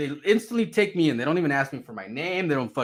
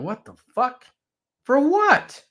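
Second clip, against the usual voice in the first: high, loud protest at the end; indignant disbelief; 9 semitones higher, 5 dB louder than usual. Retold or imitated. Man told to put hands behind back, indignant disbelief. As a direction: demand a reason, pitch shooting up. Reacts to being handcuffed.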